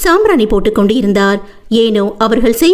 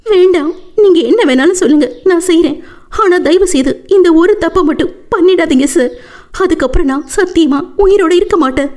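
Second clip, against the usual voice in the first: higher, fast tearful begging; sobbing plea; 7 semitones higher than usual. A narrator's reading. Woman at his feet begging him, higher and tearful. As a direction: plead through tears for mercy. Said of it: "கதறி அழுதாள்".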